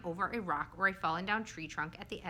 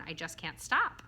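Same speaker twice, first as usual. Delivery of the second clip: plain reading voice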